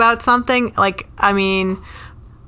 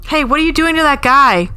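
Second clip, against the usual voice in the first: raised, indignant hey what are you doing; 5 semitones higher than usual. Retold or imitated. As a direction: stop the show and scold security. [name] stopping concert.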